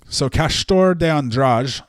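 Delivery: Castor de Andrade with Portuguese sounds, Andrade as ahn-DRAH-jee; Brazilian pronunciation. Shown as a demonstration. Host saying the boss's name, Brazilian pronunciation. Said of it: "to say it very Brazilianly".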